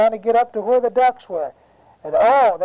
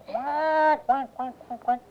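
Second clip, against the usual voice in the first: repeated quacks, fading softer; duck quacking; 7 semitones higher, 5 dB quieter, slower than usual. Acted. Storyteller plays mama duck looking for her ducklings, quacking. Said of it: "quacking away".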